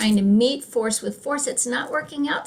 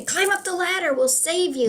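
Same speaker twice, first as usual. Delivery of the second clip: higher-pitched urging call; rescuer calling out; 9 semitones higher than usual. Acted. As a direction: call down to someone you're saving. Helicopter crew calling down in joke.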